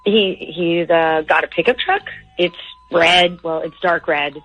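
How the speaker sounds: ordinary talk, nothing marked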